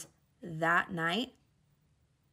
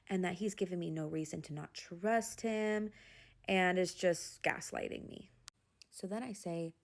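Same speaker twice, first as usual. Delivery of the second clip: normal talking voice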